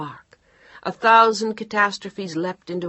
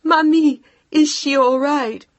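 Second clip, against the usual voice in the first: high, loud, near-sobbing cry; tearful panic; 10 semitones higher, 10 dB louder, slower than usual. Acted. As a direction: fight to hold the voice together. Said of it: "desperately trying to control her voice".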